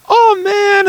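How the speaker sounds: mock kid cheering. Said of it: "If you're just like".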